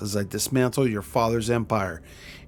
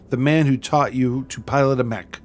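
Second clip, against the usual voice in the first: normal talking voice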